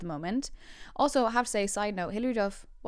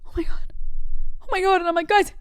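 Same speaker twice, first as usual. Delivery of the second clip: high, loud gasping reenactment; overwhelmed excitement; 11 semitones higher, 10 dB louder than usual. Retold or imitated. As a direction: build to a thrilled, mock-overcome cry. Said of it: "Hands shaking".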